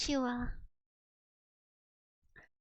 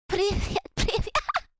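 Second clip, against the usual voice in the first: "Privyet" slow and high through a laugh; laughing practice; 6 semitones higher, 4 dB louder, slower than usual. Retold or imitated. Practising "Privyet" alone in the car, laughing. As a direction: laugh while drilling "Privyet". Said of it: "I was driving to school today".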